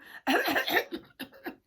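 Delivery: fussy fake coughing fit; hammed-up throaty cough; put-on cough. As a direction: cough loudly as a cover-up. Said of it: "Terrible cough".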